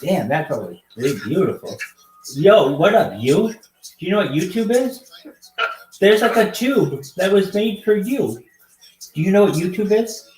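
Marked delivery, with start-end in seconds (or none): 2.3-8.4 higher, louder, bouncy stream-host voice; hyped host greeting. Acted. Hyped greeting to video-chat strangers. 9.1-10.3 higher, louder pumped-up ask; hyped host question. Acted. Hyped question, then flat repeat.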